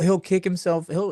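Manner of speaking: ordinary talk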